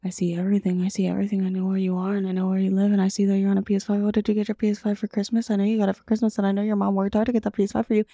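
low, monotone close-mic menace; creepy mic voice. Retold or imitated. Spooking kids close to the mic, creepy low monotone. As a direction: imitate an all-seeing creep, low and droning. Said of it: "got really close to the mic".

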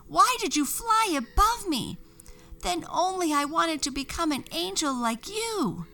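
high, loud witch scolding; high shrill scolding witch; angry scolding. A narrator's reading. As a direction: berate the angel. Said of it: "went on scolding".

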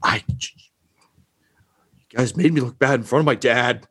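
lightly voiced sulking bad guy; humiliated villain. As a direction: sputter it, wounded and aggrieved. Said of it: "and he's just like".

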